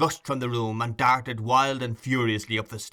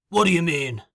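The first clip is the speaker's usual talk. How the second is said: loud, raised question; Sikes, startled demand; 5 semitones higher, 5 dB louder than usual. A narrator's reading. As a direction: demand the meaning abruptly.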